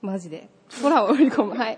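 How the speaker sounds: ordinary talk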